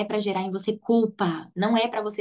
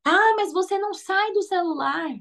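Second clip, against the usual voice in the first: higher-pitched mock complaint; nagging parent; 8 semitones higher than usual. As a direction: nag the kid about the phone like a tired parent. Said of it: "simplesmente falar assim".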